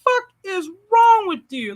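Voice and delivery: high-pitched incredulous outburst; mock exasperation. Reacting to candidate crying on video, high incredulous retelling.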